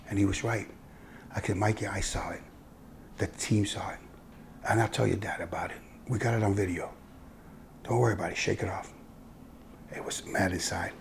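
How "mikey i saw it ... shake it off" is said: gentle consoling. Retold or imitated. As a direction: comfort the boy quietly. Coach consoling a crying boy.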